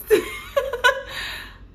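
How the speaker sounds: high-pitched burst of laughter. Real, not acted. Telling viewers about the news, high laughing burst. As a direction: laugh at the memory.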